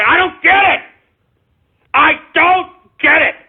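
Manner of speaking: high, loud outburst of disbelief; exasperated shout. Real, not acted. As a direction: blow up in disbelief. Exasperated outburst about Canada.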